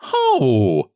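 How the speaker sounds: a touch of defiant scoff; little man scoffing at the pudgy man; scornful ho. A narrator's reading. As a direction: toss back a sharp retort. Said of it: "said the little man".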